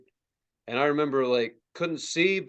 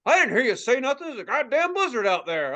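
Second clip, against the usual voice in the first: higher, irritated imitation of dad; arguing dad; 7 semitones higher than usual. Dad rebuking teen about the blizzard, irritated. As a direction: argue back as an annoyed dad. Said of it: "He's like".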